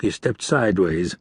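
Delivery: ordinary delivery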